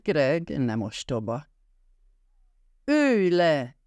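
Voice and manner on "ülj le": raised, higher-pitched command; yelled order. A narrator's reading. Greg yells at Ryan to sit, raised commanding voice. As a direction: bark it out, a contained narrator's yell. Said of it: "ordított Ryanre".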